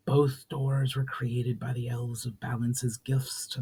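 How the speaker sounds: a spirit breathing the ancient greeting; ghostly whisper. Acted. Spirit greeting Valinar in old tongue, ghostly whisper.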